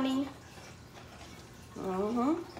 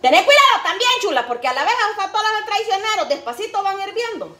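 higher, louder motherly caution; mother's warning; 9 semitones higher, 6 dB louder than usual. Retold or imitated. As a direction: warn her like a watchful mom. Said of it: "Así me decía mi mamá".

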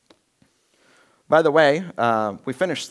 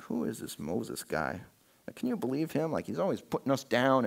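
conspiratorial hush; 7 dB quieter than usual. Retold or imitated. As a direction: lean in and stir up resentment quietly. Korah whispering to clan leaders.

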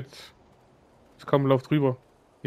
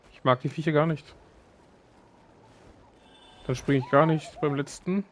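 ordinary delivery

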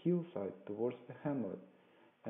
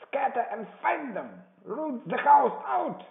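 high, loud, building command; Pew's shouted order; 14 semitones higher, 10 dB louder than usual. A narrator's reading. Blind beggar ordering a search, insistent shout. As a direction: bellow it high, stretching the final order. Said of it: "reiterated Pew, striking with his stick".

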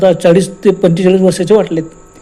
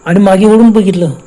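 ordinary delivery

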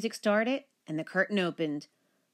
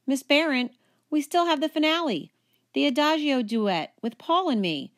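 put-on high, pleading girl's voice; lightly voiced pleading girl; 7 semitones higher, 4 dB louder than usual. A narrator's reading. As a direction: beg her not to cancel. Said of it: "I pleaded".

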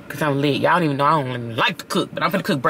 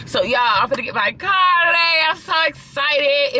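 excited shout; 10 semitones higher than usual. Real, not acted. Announcing car day, shouting.

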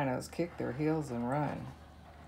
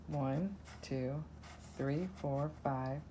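ordinary delivery

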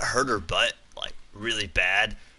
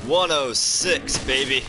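excited cheer; 5 semitones higher, 5 dB louder, slower than usual. Real, not acted. Cheering a big damage number.

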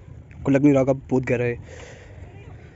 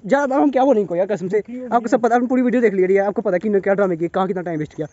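raised, higher-pitched complaint; exasperated rant; 6 semitones higher, 4 dB louder than usual. Real, not acted. Vlogger complaining to viewers about companions' drama, exasperated. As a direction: vent to the audience, fed up.

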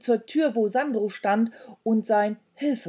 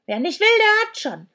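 high, loud crone voice; high-pitched dismissive cry; called out; 13 semitones higher, 7 dB louder than usual. A narrator's reading. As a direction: toss it out loudly like a huffy old creature. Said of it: "rief sie".

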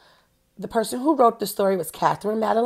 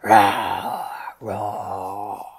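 much lower monster roar. Shown as a demonstration. Teacher showing kids a scary roar, low roaring. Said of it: "Let's hear your roar".

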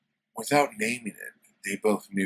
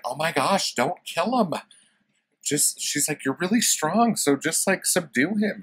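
woman's voice; 10 semitones higher, 5 dB louder than usual. Retold or imitated.

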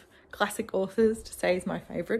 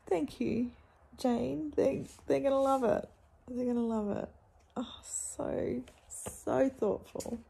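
choked up; 5 semitones higher than usual. Real, not acted. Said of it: "I'm crying".

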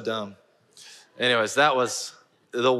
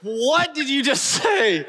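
very high, drawn-out shout of disbelief; mortified outcry; 14 semitones higher, 4 dB louder, slower than usual. Retold or imitated. Man replaying horror at his own slip, mortified shriek. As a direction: shriek it slowly at yourself in horror. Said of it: "I was mortified".

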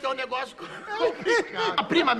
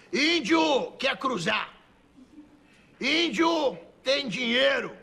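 very slow, drawn-out caricature speech; caricature índio; much slower than usual. Acted. Índio addressing the brothel madam, very slow caricature voice. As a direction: stretch each word out in halting broken Portuguese. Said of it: "Teve um í-, um índio".

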